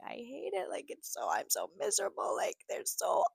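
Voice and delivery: high, soft mock-crying whine; retold sobbing. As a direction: imitate sobbing down the phone, small and high. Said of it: "sobbing on the street on the phone".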